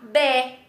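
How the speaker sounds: BÉ as plain B plus open E, without any R; simple open "beh" syllable; no tongue tremble. Shown as a demonstration. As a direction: say BÉ cleanly, tongue still. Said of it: "sem a língua tremer".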